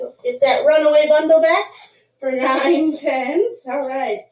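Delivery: normal talking voice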